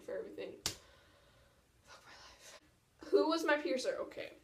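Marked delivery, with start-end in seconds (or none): 1.9-2.6 muttered under breath; barely voiced mutter. Real, not acted.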